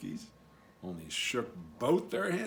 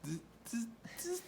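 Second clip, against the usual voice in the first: soft mocking zzz; monkey buzz; 8 semitones higher, 10 dB quieter than usual. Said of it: "and said".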